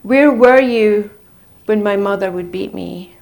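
loud, anguished accusation, each question hammered; retold screaming. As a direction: throw every grief back at him. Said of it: "I screamed".

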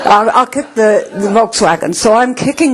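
words broken by a laugh. Real, not acted. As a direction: giggle through the line. Laughing through retold thought.

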